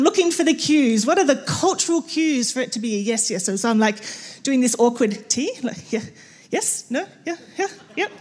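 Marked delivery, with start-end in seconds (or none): 5.9-8.1 higher, softer mumbled yeahs; ambiguous Turkish guest, mumbling yeah yeah yep; mock hesitant yes-no. Guest answering the tea offer, mock hesitant.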